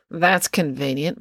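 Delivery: ordinary delivery, nothing marked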